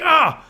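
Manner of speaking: high, loud mock yelp; retold startled scream. Runner startled by a bolting bear, mock scream. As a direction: act out a jolt of fright. Retells own startled reaction.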